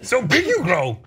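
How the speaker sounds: high-pitched little-creature impression; Yoda. Jedi master stealing a stranger's food, high creature impression. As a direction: squeak it out like the old Jedi master. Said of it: "when he first meets Yoda on Dagobah".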